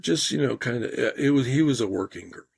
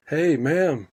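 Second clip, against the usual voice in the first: raised call to a stranger; 6 semitones higher, 5 dB louder than usual. Retold or imitated. Catching a shoplifter, a loud call. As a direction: call her out loudly.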